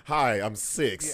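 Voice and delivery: mock-corny greeting, low and drawn out; corny self-introduction. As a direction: say it slow and cheesy, half laughing. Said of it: "He does it all corny".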